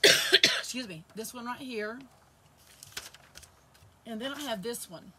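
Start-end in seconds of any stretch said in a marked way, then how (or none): none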